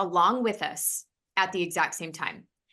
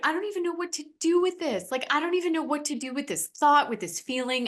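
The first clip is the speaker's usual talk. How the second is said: higher and fast, bewildered by the feeling; overwhelmed exclaim; 8 semitones higher, faster than usual. Retold or imitated. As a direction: blurt it out, stunned and helpless. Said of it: "I was like".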